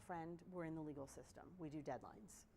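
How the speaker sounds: ordinary talk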